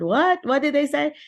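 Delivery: high, loud startled what; sudden high-pitched disbelief; surprised what. Retold or imitated. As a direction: react like you can't believe it. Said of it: "I said".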